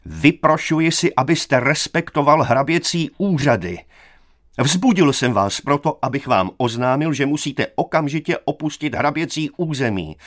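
high-pitched indignant official tirade; enraged youth. A narrator's reading. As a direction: order him out in high outrage. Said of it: "Teď už byl mladík zlostí bez sebe". (rozhořčeně, zvýšeným hlasem)

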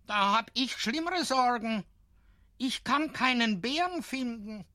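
put-on high child's voice with a whine of worry; a small boy fed up with searching; little boy complaining. A narrator's reading. Boy complains about no bear.

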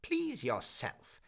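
a miserly crone laying down the rules; the pawnbroker telling the student his pledge has lapsed, coldly; stern old pawnbroker. Acted. Old woman dismisses him.

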